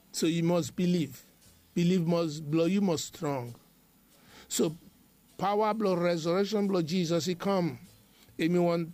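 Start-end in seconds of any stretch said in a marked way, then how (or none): none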